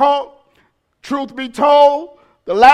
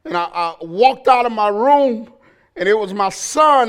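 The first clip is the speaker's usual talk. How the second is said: ordinary delivery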